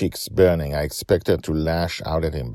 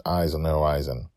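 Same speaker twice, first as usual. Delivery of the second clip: ordinary delivery, nothing marked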